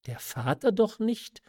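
Der Vater doch nicht, asked high in alarm; startled cry. A narrator's reading. Marquise asks her mother about the father, startled and high. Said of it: "rief die Marquise".

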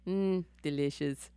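a hint of a hungry villain savoring his prey; lightly voiced Night King. Night King eyeing Bran like a cake, softly relishing. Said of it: "Night King is looking at him like".

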